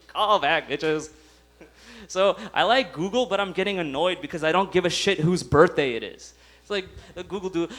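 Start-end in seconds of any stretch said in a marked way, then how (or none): none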